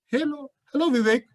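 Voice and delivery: polite, measured hello; formal greeting. Shown as a demonstration. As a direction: greet him formally and carefully. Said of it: "both the tones are completely different".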